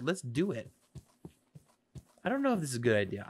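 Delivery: ordinary talk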